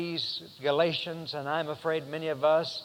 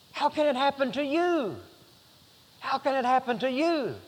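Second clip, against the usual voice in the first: higher, pained plea repeated; dismayed question; 8 semitones higher than usual. Acted. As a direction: ask it in disbelief.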